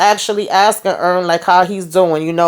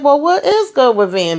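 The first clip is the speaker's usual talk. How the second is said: puzzled reaction; 7 semitones higher than usual. Retold or imitated. Acts out own puzzled reaction.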